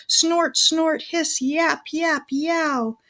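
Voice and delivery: snort, hiss and yap like animals; animal noises. Acted. Animals adding their voices.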